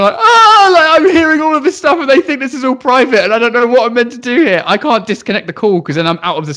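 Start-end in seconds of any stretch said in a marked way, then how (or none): 0.2-0.7 sudden high, loud panicked "Ah!"; a yelp of helpless panic; frantic cry. Retold or imitated. Teacher panics while overhearing the parents, frantic high yelp. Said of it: "frantically going".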